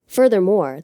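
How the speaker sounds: plain reading voice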